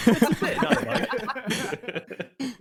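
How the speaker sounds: squeaky giggle wrapped around the words, dragged out slowly; laughing. Real, not acted. Cohost teasing the gaffe, laughing through the words.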